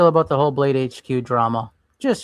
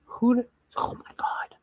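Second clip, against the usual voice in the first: breathless stunned whisper, pitched up; shocked whisper; 9 semitones higher, 5 dB quieter than usual. Real, not acted. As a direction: gasp it out in disbelief. Shocked at knife sale listing.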